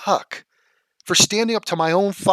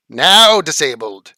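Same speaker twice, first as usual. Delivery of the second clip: loud command; 9 dB louder, slower than usual. Real, not acted. Yelling at chatter.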